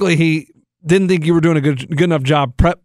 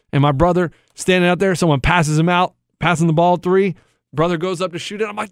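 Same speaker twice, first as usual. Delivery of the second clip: ordinary talk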